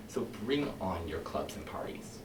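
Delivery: soft, unvoiced start; a whispered invitation to the firemen; hushed whisper. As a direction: begin in a conspiratorial whisper.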